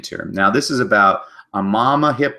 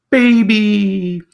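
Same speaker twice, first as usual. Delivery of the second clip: high, louder held call; tortoise; 13 semitones higher, 6 dB louder than usual. Acted. Tortoise answering the baby hippo, high and louder. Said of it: "the tortoise ... saying".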